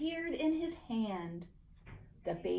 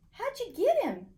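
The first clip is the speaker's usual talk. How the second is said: amazed question; 11 semitones higher, 5 dB louder than usual. A narrator's reading. Rosie amazed.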